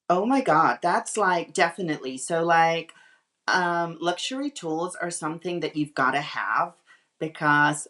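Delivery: American accent attempt. Shown as a demonstration. British stylist answering on luxury tools, attempted American accent. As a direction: put on your best American accent. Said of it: "Right, so American".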